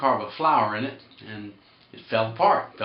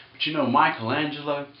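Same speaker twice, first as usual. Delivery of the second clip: usual speaking voice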